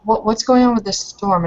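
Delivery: normal talking voice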